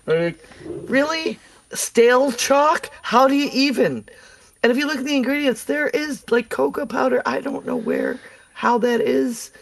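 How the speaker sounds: high-pitched, incredulous candy rant; appalled, voice pushed way up; comic outrage. Real, not acted. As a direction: squeak with disbelief at how awful it is. Mock outrage at candy's ingredients.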